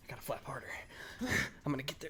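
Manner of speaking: soft, breathy whisper of a straining bird; a joke bird trying to fly home; mock whisper. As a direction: whisper it as if flapping hard.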